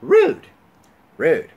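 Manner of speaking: high, loud mock exclamations; imitating a heckler. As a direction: mimic an annoying moviegoer's repeated outbursts. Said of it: "she would say".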